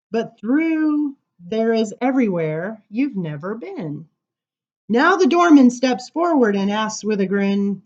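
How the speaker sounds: measured, deliberate poem recital; poem reading. Acted. Poet reading his poem to the audience, measured. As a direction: read the verse slowly to the room.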